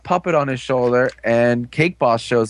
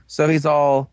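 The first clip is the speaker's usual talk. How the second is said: ordinary delivery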